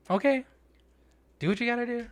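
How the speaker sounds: high-pitched, strained okay; irritated compliance. Retold or imitated. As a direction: go up high, holding back irritation. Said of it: "Irritated still, right?".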